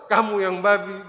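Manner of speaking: a loud, offended comeback hurled back; angry retort. Retold or imitated. Driver going uphill shouting back at the other driver, offended and loud. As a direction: shout the insult right back at him. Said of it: "Yang naik dia balas".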